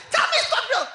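very high, loud panicked cries; terrified shrieking. Retold or imitated. Dying auntie clutching Kenneth, shrieking in terror. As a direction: beg in terror at death's door. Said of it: "I'm so frightened".

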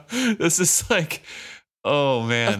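high, slow, laughing through it; laughing at the story. Real, not acted. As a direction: break up laughing.

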